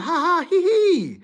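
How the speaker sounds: high mocking giggle; mock class clown laugh. Mocking the class clown, high giggle. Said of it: "You was the class clown".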